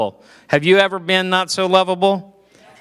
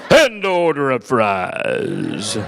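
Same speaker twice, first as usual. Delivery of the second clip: mock preacher low drawn out; 6 semitones lower, much slower than usual. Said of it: "Not for my dad".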